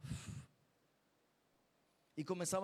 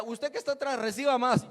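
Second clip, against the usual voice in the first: loud, higher call to the back row; loud preacher's call; 5 semitones higher, 10 dB louder than usual. Retold or imitated. Calling to the man at the back, loud. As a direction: call out over the crowd with a laugh. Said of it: "yo decía".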